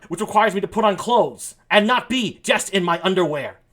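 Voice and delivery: hammed-up outrage, punching the words; ranting indignation. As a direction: build to a loud comic protest. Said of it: "now that my rant's over".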